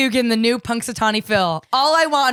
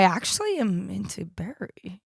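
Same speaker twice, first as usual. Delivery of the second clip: hushed, softer admission; confessional whisper; 2 semitones lower, 8 dB quieter, slower than usual. Real, not acted. Host confiding a crush to co-host, whispered. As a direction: whisper it like a secret.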